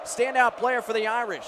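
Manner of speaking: usual speaking voice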